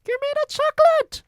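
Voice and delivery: put-on very high, loud, fast boy's shout; cartoonish high boy shouting; Bruno shouting. A narrator's reading. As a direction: yell it as a demanding kid. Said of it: "shouted Bruno".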